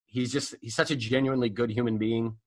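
ordinary talk

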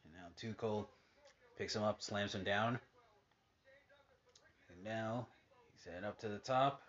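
casual ringside play-by-play, hushed between moves; wrestling commentator. Acted. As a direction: call each move as it lands. Calling the match on TV.

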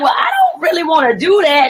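high, quick mock protest; reluctant protest. Retells balking at the pill.

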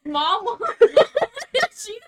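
loud, high words broken by laughter; cracking up mid-sentence; helpless laughter. Real, not acted. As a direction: slowly squeeze it out while laughing. Cracking up at friend's remark.

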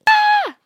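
sudden high piercing scream, very loud; terrible scream. A narrator's reading. Malfoy screaming at the hooded figure, loud terrified scream. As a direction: let out a short shriek of terror. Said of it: "Malfoy let out a terrible scream".

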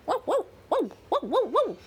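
high yappy dog bark. Acted. Dog Sam barking.

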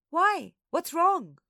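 put-on high, loud child's voice; squeaky, eager questioning; nosy little sister. A narrator's reading. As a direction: butt in excitedly as a little girl. Little sister Nana eagerly butts in.

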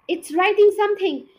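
high, loud shriek; terrified child screaming. A narrator's reading. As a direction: yell it in panic. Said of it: "The scream".

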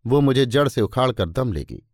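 ordinary delivery, nothing marked

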